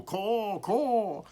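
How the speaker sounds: high crow caws from a tree; crow call. A narrator's reading. Lookout crow calling from a nearby tree, high caws. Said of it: "he heard a crow call".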